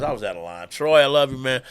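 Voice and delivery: ordinary talk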